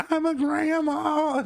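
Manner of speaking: high, slow hammed-up old lady plea; old grandma voice. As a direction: whimper it like a frail grandma. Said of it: "she's just".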